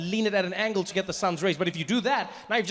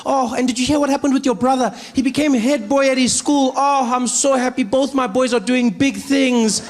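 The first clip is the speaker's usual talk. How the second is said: high, louder, doting mother; gushing mom voice; 6 semitones higher, 4 dB louder than usual. Retold or imitated. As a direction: gush like a proud mom on the phone. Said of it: "She's like".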